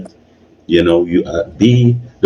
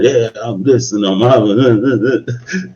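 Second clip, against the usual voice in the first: higher-pitched, drawn-out show-off bluster; mock loudmouth swagger, pitched up and trailing into nonsense; mock aggressive self; 4 semitones higher, much slower than usual. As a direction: imitate your old over-the-top self, dragging it out. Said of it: "I used to be aggressive".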